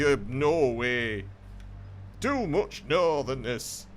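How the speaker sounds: loud, hammed-up northern English; exaggerated northern English accent. Gamer mock-boosting the northernness, in a hammed-up northern English accent. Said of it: "that's maximum northern capacity".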